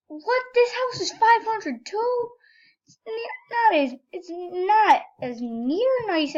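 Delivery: high-pitched squeal of surprise; squeaky, incredulous outburst; shocked disbelief. Real, not acted. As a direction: react in disbelief, voice shooting way up. Reacting in shock to the game.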